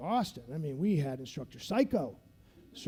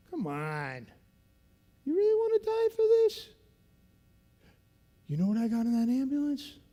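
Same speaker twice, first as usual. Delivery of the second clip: high, fast taunting call; retold instructor taunt; 7 semitones higher, 3 dB louder than usual. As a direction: tempt them out of the water.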